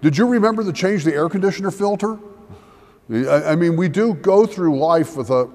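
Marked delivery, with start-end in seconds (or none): 0.0-2.2 quick, higher nagging spousal question; mock last words. Pastor imitating his own dull last words to his wife, light mock. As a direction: rattle it off like a mundane chore reminder. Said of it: "they're going to be something like".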